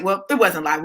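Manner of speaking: normal talking voice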